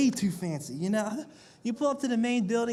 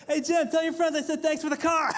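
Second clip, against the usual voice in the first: higher smug friend voice; smug rich friend; 7 semitones higher than usual. Retold or imitated. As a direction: brag like a rich buddy. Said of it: "he's gonna pull up".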